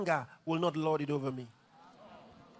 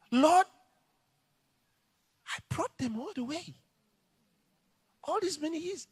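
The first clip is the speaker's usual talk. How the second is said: high, begging plea; pleading Moses; 11 semitones higher than usual. Retold or imitated. Moses begging God, high and pleading. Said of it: "God said".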